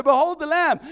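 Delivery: loud, high proclamation; proclaiming. Retold or imitated. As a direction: announce it to the crowd. John the Baptist proclaims.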